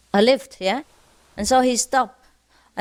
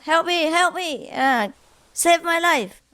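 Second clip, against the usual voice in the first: higher, mock desperate call for help; shouting for help; 7 semitones higher than usual. Man hanging from cliff calls for help, mock desperate cry. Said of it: "he was shouting for help".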